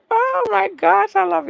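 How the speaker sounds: blushing squeal. Real, not acted. Host thanking listeners, gushing high squeal. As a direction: squeal it out, overcome by the love. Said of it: "I always blush when I get right here".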